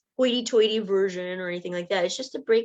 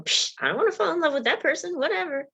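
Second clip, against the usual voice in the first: dismissive; 8 semitones higher, faster than usual. Retold or imitated. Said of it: "you might go".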